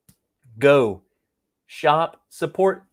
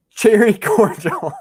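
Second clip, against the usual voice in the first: higher voice breaking into laughter; laughing; 6 semitones higher, 3 dB louder than usual. Real, not acted. Tongue-tied streamer retries cherry cordial, laughing. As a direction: crack up halfway through the words.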